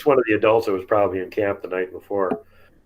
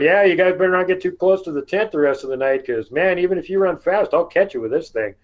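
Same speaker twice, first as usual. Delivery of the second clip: higher-pitched teasing threat; retold taunt, pitched up; mock warning; 9 semitones higher than usual. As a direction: call a playful warning into the dark. Warns creatures with flashlight.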